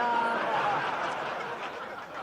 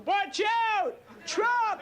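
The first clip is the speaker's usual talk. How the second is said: loud, higher-pitched alarm yell; startling yell; 8 semitones higher, 4 dB louder, much slower than usual. Acted. Father waking a sleeping kid as a joke, yelling. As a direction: yell it like a sudden emergency warning. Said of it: "shine two flashlights in my eyes and yell".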